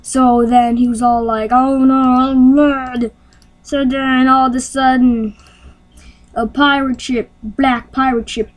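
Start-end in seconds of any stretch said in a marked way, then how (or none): 1.5-3.1 goofy put-on angry voice; mock mad. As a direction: groan it in hammed-up anger. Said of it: "he got mad".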